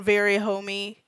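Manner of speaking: usual speaking voice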